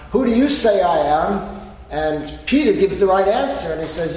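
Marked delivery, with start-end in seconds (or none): 0.1-1.7 loud, high and pointed; pressing question. Acted. Jesus pressing the disciples, loud and pointed. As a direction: throw it straight at them.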